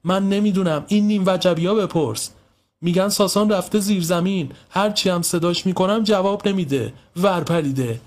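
high, exasperated complaint; mother at the end of her patience; thoroughly fed up. A narrator's reading. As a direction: rant crossly about the naughty kids. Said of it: "حسابی کفری شده بود".

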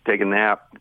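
usual speaking voice

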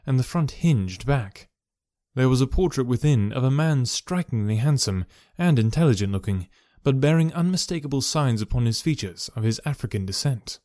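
usual speaking voice

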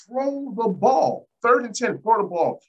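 high, slow, exasperated order; frustrated plea. Real, not acted. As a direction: beg the coaches to just pass it. Fan yelling at the coaches.

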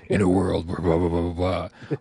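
low movie-trailer announcer voice; trailer narrator. Acted. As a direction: spoof that deep trailer guy, blahs and all. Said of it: "every single trailer has that guy".

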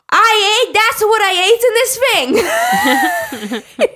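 high, loud, drawn-out horror; horrified shout. Retold or imitated. Carlo realizing he ate eel, shouting in horror. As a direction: cry out in disgust.

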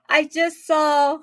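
breathless from running upstairs; panting, words squeezed between breaths. Real, not acted. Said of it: "I'm out of breath".

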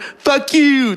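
high-pitched mock squawk of abuse; cursing newborn. Newborn friend cursing at the doctor, high and indignant. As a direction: play a baby born cursing. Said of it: "Were you born saying that word?".

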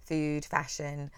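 ordinary talk

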